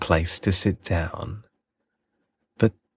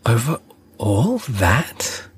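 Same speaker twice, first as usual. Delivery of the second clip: higher, slow wondering question; amazed question; 5 semitones higher than usual. A narrator's reading. Prince asks the king about the stars, amazed. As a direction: marvel at it slowly. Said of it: "asked the little prince".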